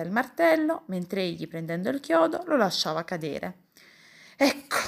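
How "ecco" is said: short, louder aggrieved remark; huffy and put out; resentful tone. A narrator's reading. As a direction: say it with open resentment. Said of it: "diceva in tono risentito".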